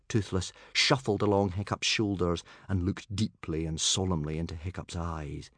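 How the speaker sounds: ordinary delivery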